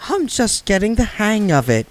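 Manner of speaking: pleading to keep playing; anxious grin. Granny voiced.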